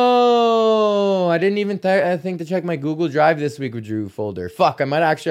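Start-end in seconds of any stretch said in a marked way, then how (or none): 0.0-3.4 real sudden realisation. Real, not acted. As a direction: let it dawn on you, pitched way up. Sudden realisation about his backup.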